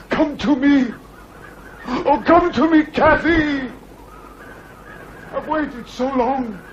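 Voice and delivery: anguished plea. Acted. Heathcliff begging Cathy's ghost to appear, anguished plea. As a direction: pour out grief to a lost love.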